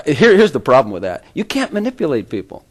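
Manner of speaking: usual speaking voice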